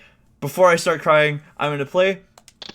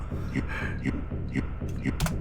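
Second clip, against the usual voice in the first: silent, helpless laugh; breathless laugh; 8 dB quieter than usual. Real, not acted. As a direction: laugh at your own blunder, airless. Laughing at own mistake.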